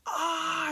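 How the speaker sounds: very high yelp of pain; hero scream. Retold or imitated. Spider-Man hit by the blast, high pained scream. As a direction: cry out as the leg burns. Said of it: "he's, like, screaming".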